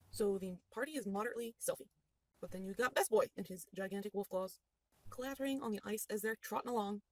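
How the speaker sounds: ordinary talk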